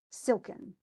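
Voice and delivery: "silken" said with silk strong and ken light; stress on SILK. Shown as a demonstration. Teacher demonstrating the stressed syllable to her student. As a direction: hit silk and let ken fall away. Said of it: "the stress in silk".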